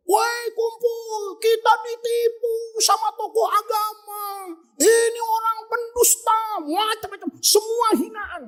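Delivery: high, drawn-out rabble-rousing call; mock shouted accusation. Disciple denouncing the religious leader, high hammed-up cry. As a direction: cry out a scandal to the crowd. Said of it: "Dia teriak".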